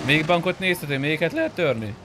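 usual speaking voice

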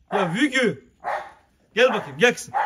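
loud, high call to his dog. Real, not acted. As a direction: call her over from across the yard, brightly. Calling his dog Vurgun over. (köpeğini yüksek sesle çağırma)

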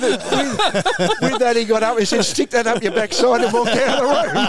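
words squeezed out through high laughter; can barely get it out for laughing; giggling through the end of the story; laughing while telling. Real, not acted.

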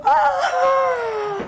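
long, high pained wail; anguished cry.

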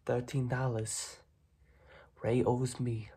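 ordinary talk, nothing marked

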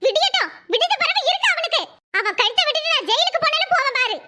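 put-on high, vengeful old aunt's threat; furious aunt. Aunt threatening the attacker to the young man, high angry voice. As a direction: swear revenge on the boy.

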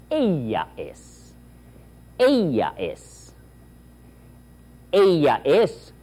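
slow repeat-after-me, building up; slow drill. Shown as a demonstration. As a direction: drill it slowly, growing each time.

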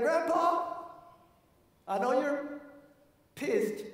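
put-on a boy caught out, talking fast to cover; pleading grandson. Grandson apologizing to grandfather in a high kid voice.

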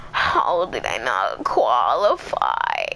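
low, slow, dejected; deflated disbelief. Real, not acted. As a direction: drag it out, crushed.

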